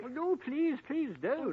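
high, loud, overcome with delight; swooning protest. Acted. Tweedles begs Jane to stop describing cereal, swooning. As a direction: beg her to stop, mock agony of craving.

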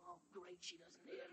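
hoarse whisper. Acted. Voice gives out after shouting.

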